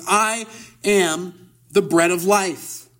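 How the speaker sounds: very slow, weighted scripture reading; solemn declaration. Acted. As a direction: set each opening word apart with pauses. Reads Jesus's declaration from scripture.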